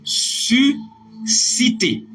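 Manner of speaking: drawn out slowly, syllable by syllable; slow dictation. Shown as a demonstration. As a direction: stretch each syllable for the class to write. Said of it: "Nous allons répéter".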